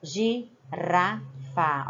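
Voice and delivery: Girafa, very slow, with a light flapped r; tapped R. Shown as a demonstration. Teacher drawing out the word to show the flapped R. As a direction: stretch Girafa out, tap the r. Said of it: "Olha o som tremido".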